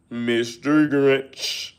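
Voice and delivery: very slow, drawn-out Grinch name in a put-on voice. Streamer mocks the troll's Christmas-hating persona, slow drawl. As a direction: stretch the name out with a sneer.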